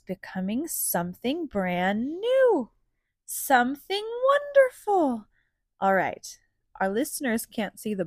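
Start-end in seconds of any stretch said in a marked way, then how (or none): 2.2-5.3 awestruck wonder. A narrator's reading. Wonder at new sprout.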